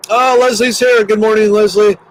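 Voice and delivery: light up as a friend joins; happy surprise at a familiar name; delighted greeting. Real, not acted. Delighted to see a viewer arrive.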